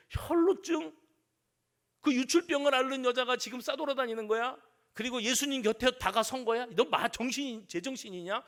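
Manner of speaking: high, loud crowd indignation; outraged scolding. Acted. Bystanders rebuking the sick woman, outraged and loud. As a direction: scold her in shocked disbelief.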